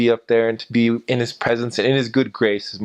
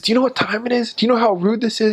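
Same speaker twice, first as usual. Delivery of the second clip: high-pitched mock yell of an irritated neighbor; retold angry scream; 13 semitones higher, faster than usual. Woken neighbor yelling at missionaries at his door, high-pitched angry mock yell. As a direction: shriek it like a woken man in pajamas. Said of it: "he just screamed at us".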